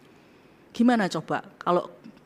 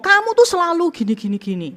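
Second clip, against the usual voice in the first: high, loud blaming jab; mock accusing outburst; 10 semitones higher, 6 dB louder than usual. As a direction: throw the blame at them. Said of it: "Hindari ngomong".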